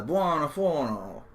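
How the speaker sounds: slow faux-Italian phrase; hammed-up Italian accent; mock Italian. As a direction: say it like a tourist's Italian. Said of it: "they say".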